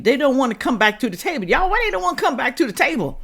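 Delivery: rising, mocking disbelief; scoffing rhetorical question; incredulous. Real, not acted. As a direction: throw the question at the viewers high. Mocking rhetorical challenge to viewers.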